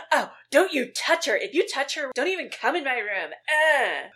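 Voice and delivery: loud, high-pitched bratty outburst; mock screaming. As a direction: yell a hammed-up warning down the hall. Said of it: "screaming these things".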